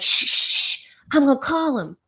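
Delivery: hammed-up Angela shushing the room; hushing Angela. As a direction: imitate a woman hushing everyone to make a call. Voicing Angela hushing people.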